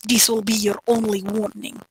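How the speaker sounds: put-on Sokovian-style accent, measured warning; returning foreign accent. Heroine warns the soldiers, in an imitated foreign accent. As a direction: imitate her thick accent. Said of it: "all her accent comes back".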